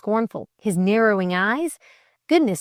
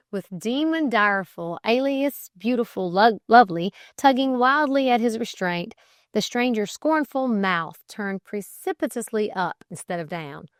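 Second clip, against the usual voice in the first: plain reading voice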